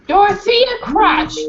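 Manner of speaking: snapped name. Acted. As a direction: bite off her own name. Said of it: "the woman snapped".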